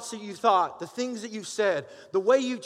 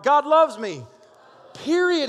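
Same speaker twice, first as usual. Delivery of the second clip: "God loves me" higher and louder, driven home; louder repeat; 6 semitones higher, 6 dB louder, slower than usual. Shown as a demonstration. Pastor pushes the church to say it again, louder. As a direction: belt it so it sinks in deep. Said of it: "Say it one more time so you can get it down deep in your heart".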